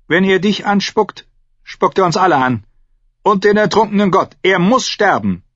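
high, loud, vehement insistence; merciless demand. A narrator's reading. Uncle demands death for the spitting prisoner, vehemently. Pitiless priest insists on execution.

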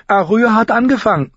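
put-on high, loud girl's tattling; hasty, eager accusation. Sansa tells on her sister to father, hasty and eager. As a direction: blurt it out to get in first. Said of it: "sagte Sansa eilig, eifrig darauf bedacht, als Erste zu sprechen".

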